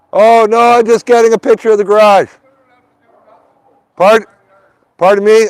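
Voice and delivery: high, loud call across the yard to a neighbor; loud, high call to the angry neighbor. Real, not acted. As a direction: project it over to the neighbor's yard.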